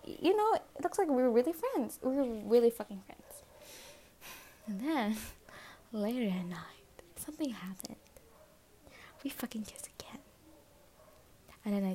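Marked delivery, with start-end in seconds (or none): 5.9-10.2 hushed, secretive whisper; whispering a guilty confession; confiding whisper. Real, not acted. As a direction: spill the secret under your breath.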